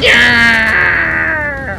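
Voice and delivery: loud triumphant yell; victory shout. Real, not acted. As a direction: cheer out the win.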